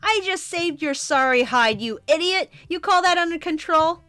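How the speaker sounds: loud, heated squabble between two hotheads; bickering comic heroes. Acted. Streamer voicing squabbling comic characters, loud and heated.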